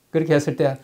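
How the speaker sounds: normal talking voice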